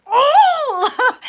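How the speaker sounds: high, loud furious yelp breaking into a laugh; angry laughing cry. Retold or imitated. Herself waking up furious, high loud cry with a laugh. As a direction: let out an outraged yelp.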